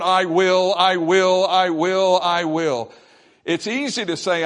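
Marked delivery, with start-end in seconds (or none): 0.0-2.9 "I will" repeated loudly on a raised pitch; driving, louder "I will", evenly repeated; insistent repetition. Retold or imitated. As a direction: hammer out each "I will" like a vow. Preacher hammers the psalmist's repeated vow.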